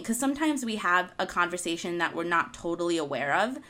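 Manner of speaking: ordinary talk, nothing marked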